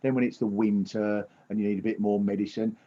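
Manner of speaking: ordinary talk, nothing marked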